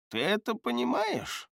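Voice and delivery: put-on high, thin voice of an old healer; old man voice. Gerton asks Shadeya.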